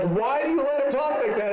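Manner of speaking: high, scandalized relative; mock outrage. Grandparents questioning a dad about his cursing son, mock outrage. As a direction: act out appalled family demanding an answer. Shocked relatives at child's swearing.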